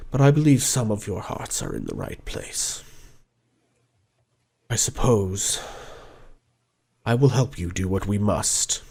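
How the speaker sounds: put-on an old dragon admitting his greed; remorseful gold dragon. The dragon Arnax confesses to the party, low and soft.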